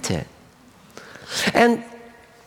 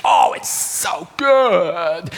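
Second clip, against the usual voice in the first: slow, drawn-out relish; savoring; much slower than usual. A narrator's reading. Lamb savoring another patch of clover, relishing it. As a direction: stretch each word with pleasure.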